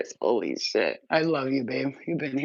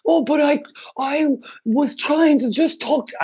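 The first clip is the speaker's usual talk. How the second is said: high, slow, whining mock plea; pitched-up, drawn-out pleading parody; whiny mock guilt-trip; 9 semitones higher, 4 dB louder, slower than usual. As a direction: mimic someone guilting you into talking. Mocking a guilt-tripping person.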